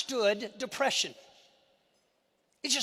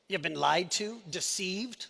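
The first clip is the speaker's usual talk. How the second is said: ordinary talk, nothing marked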